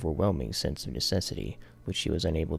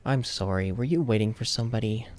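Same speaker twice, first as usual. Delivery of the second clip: put-on higher, polite young man; an awkward, polite apology; 6 semitones higher, 4 dB louder than usual. A narrator's reading. Yuzuru apologizes to girl.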